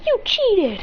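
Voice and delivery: put-on high, indignant kid's protest; kid's accusation. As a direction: protest like a child who lost.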